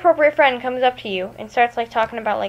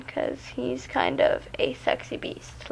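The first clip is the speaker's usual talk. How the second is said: whispering; 3 semitones higher, 10 dB quieter, slower than usual. Real, not acted. Teasing aside about Peter Pan.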